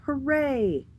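high, jubilant hooray; happy cheer. A narrator's reading. Mister H cheers after cure.

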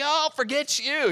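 mock dismissal. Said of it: "I was mad".

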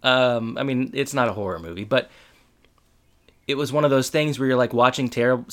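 ordinary talk

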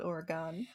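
OREGON ending in a full "gone"; outsider pronunciation. Shown as a demonstration. Admitting to the co-host a stubborn habit, OREGON with open final vowel. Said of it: "I'm probably still gonna call it".

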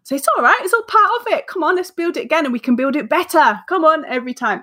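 high, sing-song reassuring mum; encouraging parent. Retold or imitated. Mum cheering daughter over fallen Lego, high reassuring. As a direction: coax a child to try again.

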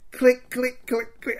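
high, clipped mouth clicks of an empty trigger; empty gun clicks. Retold or imitated. Imitating his empty pistols clicking in the fight. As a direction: click like a dry-fired pistol.

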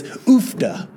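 "Oofda" as a Minnesota exclamation, falling. Retold or imitated. Quoting his Minnesota friend, a falling "Oofda". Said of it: "One thing he would say is".

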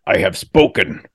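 lightly gravelly character imitation; a hint of gravel in a quoted catchphrase. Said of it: "with that gravelly voice".

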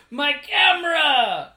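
loud high drawn-out cry; anguished wail. Acted. Tourist whose camera just exploded, wailing in dismay. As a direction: wail it slowly, hammed-up dismay.

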